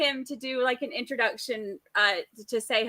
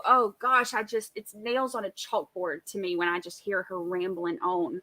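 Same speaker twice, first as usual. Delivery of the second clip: normal talking voice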